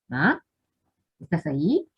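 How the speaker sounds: tentative rising question; questioning call. Pretend. Child coming home to an open door, asking for mum. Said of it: "¿estará mi mamá?".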